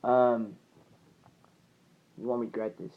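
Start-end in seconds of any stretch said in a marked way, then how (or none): 2.2-2.9 low, soft assurance; softly spoken vow; earnest promise. Retold or imitated. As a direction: quietly promise it, retold. Quoted promise, repeated after pause.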